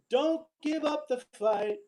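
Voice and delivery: ordinary delivery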